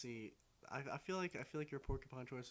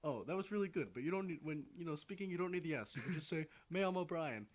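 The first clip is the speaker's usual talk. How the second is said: higher, lightly voiced Mexican cartoon guy; Mexican cartoon character; 5 semitones higher than usual. Retelling Family Guy to friends, imitating the Mexican guy. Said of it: "like, Mexican guy goes".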